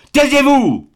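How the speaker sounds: put-on roaring baron silencing the young man; thundering shout. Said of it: "cria d'une voix de tonnerre".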